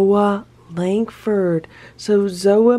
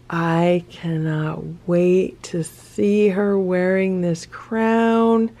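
ordinary talk

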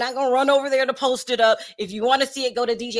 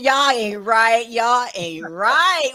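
drawn-out teasing scold; 4 dB louder, much slower than usual. Real, not acted. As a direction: stretch it out, playfully scolding the gossips.

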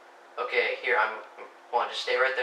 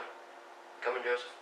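whispering; 7 dB quieter, slower than usual. Real, not acted. Whispered reassurance to teammate.

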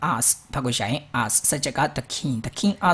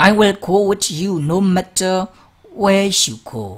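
louder and higher, slow and heartfelt promise; loyal queen's pledge; 6 semitones higher, 6 dB louder, much slower than usual. Acted. Queen promises the king she will follow him, raised earnest voice. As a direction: proclaim it slowly, full of devotion.

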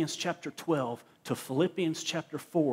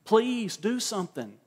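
mock pleading; 6 semitones higher, slower than usual. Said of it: "people look to heaven and go".

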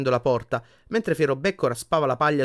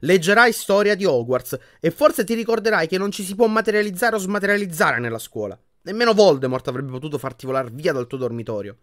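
higher and louder, fed up; exasperated; 7 semitones higher, 6 dB louder than usual. A narrator's reading. Hermione correcting Harry's fears, exasperated. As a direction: sigh it out at him. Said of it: "disse Hermione esasperata".

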